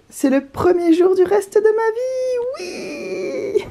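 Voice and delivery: high, slow, grand announcement; dramatic proclamation. Acted. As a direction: sing it out like a grand finale. Dramatic first-day announcement.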